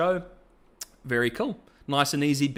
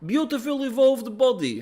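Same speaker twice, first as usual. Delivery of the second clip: much higher, put-on zealous voice; Victor; 10 semitones higher, 4 dB louder than usual. Victor brushing off Singed's plea, high zealous voice. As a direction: play a gleefully insistent, high-pitched zealot. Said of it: "And he's like".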